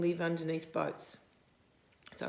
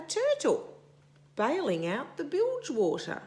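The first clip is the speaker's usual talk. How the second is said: much higher, louder storybook reveal; excited reveal; 7 semitones higher, 4 dB louder than usual. A narrator's reading. Reading to a child, revealing the turtle excitedly. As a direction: burst out with the surprise.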